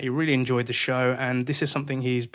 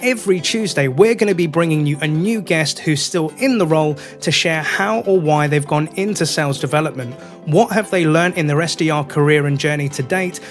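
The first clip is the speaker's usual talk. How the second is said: loud, lifted announcer-style intro; podcast intro read; 4 semitones higher, 7 dB louder than usual. Acted. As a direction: punch out the show's opening, higher and louder. Recorded show intro read.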